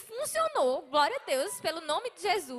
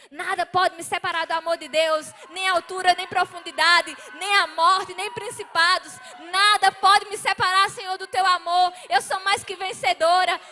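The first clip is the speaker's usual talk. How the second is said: loud rising proclamation, pitch raised; loud declaration; 3 semitones higher, 7 dB louder than usual. Retold or imitated. Running to church, a loud triumphant proclamation. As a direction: shout it out in triumph.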